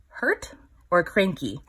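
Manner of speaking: usual speaking voice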